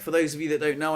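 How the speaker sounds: ordinary talk, nothing marked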